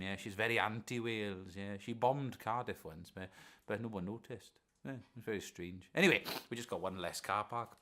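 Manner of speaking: normal talking voice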